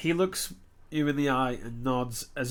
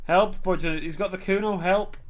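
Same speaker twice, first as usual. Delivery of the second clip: higher, louder acted cries; yelling for help; 4 semitones higher, 5 dB louder, slower than usual. Child yelling at the windows, pleading yell. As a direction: call out for rescue as the child. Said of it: "the child yells at the windows".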